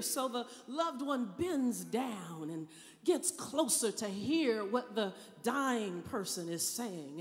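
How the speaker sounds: normal talking voice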